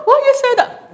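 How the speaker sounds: high, loud squeaky daughter; mock daughter whine. Daughter shocked by her mother's remark, high squeak.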